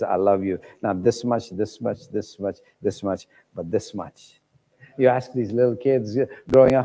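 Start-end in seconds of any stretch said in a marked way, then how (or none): 0.0-4.4 tender. Pretend. Voicing Jesus's love.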